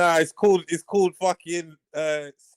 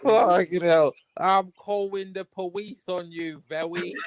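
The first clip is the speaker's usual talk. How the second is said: ordinary talk, nothing marked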